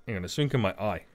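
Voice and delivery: usual speaking voice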